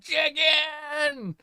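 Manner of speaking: high, drawn-out mock scream; imitated scream. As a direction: holler it long and high, like him. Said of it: "He would just be like".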